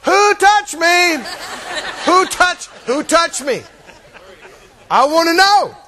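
insistent high call. Retold or imitated. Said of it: "he just looks at him and goes".

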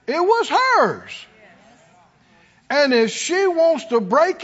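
high-pitched, driving sermon delivery; full-force preaching. Real, not acted. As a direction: preach it hard, phrase by punched phrase.

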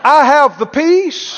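ringing call-and-response proclamation; out-loud declaration. Shown as a demonstration. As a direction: shout it out for the congregation to repeat. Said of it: "Everybody said out loud".

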